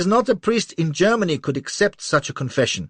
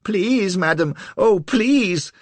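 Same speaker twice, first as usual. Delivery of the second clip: high, loud, desperate begging; nearly weep as you beg; near tears; 13 semitones higher, 8 dB louder, much slower than usual. A narrator's reading. Little man begging Lola on the verge of tears, high and desperate. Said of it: "on the verge of tears".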